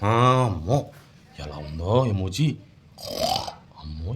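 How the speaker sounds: low, slow, drawn-out pretend dread; mock alarm. Teacher reacting to an angry emoji for a child, mock alarm. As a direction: play awed and scared, slow and hushed.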